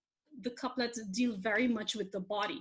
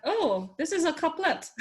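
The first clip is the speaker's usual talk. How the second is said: retold discovery, higher and louder; sudden realization; 7 semitones higher, 6 dB louder than usual. Speaker recounts spotting the couplet to listeners, delighted realization. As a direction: act out the moment it dawned on you.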